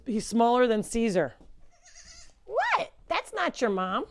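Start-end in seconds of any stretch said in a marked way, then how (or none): 2.5-4.1 bright pet-talk coo. Real, not acted. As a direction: coax the animals sweetly. Cooing at the goat.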